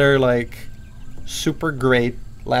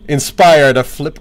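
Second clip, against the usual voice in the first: real sudden loud outburst, drawn out; 9 dB louder than usual. Real, not acted. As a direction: belt it out far too loud, slowly. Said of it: "that was really loud for me".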